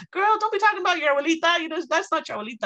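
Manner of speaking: high, rapid-fire motherly reproof; mock scolding. Mom to daughter who mistook the guest for grandma, fast scolding.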